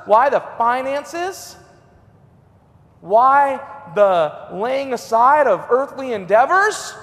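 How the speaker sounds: ordinary talk, nothing marked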